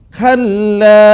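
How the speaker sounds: Kalla with the a held long and loud. Shown as a demonstration. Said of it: "panjang kallanya". (Kalla dengan mad panjang)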